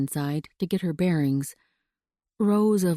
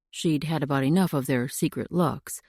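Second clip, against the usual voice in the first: ordinary delivery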